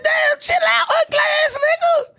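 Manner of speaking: high mock holler; retold shout. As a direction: yell it out high, retelling your outburst. Retold calling out to someone.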